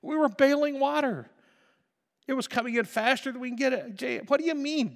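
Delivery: high, loud, fast protesting disciple; voicing a disciple. A disciple excusing their fear to Jesus, high and loud in panic. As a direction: defend yourself in a panic. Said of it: "it's like".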